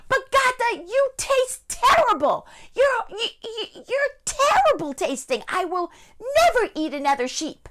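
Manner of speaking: high, wailing outrage; disgusted wolf. Acted. Wolf with burning mouth scolding the sheep, high disgusted wail.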